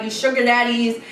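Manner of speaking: normal talking voice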